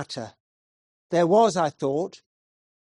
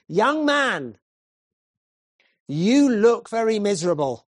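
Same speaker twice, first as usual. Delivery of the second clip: stern preacher address; 3 semitones higher, 5 dB louder than usual. Acted. Preacher addresses stranger directly.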